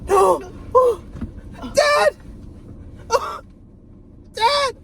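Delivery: loud, high cries of horror; hammed-up grief-stricken shrieks; acted horrified screams. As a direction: cry out as if Dad's been shot. Acting horror at dad's shooting.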